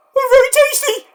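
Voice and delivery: high, loud, squeaky ad pitch; squeaky mascot voice. Pretend. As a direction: shout it like a goofy commercial mascot. Mock ad line.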